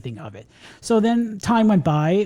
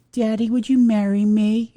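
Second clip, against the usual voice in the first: lightly imitating a small girl, a bit higher and laughing; little daughter voice; 5 semitones higher than usual. Daughter asking daddy to marry her, lightly imitated girl voice. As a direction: play the seven-year-old proposing sweetly. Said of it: "my seven-year-old daughter, like, opens up the iPad".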